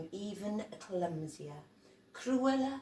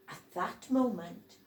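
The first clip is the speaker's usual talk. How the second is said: ordinary delivery, nothing marked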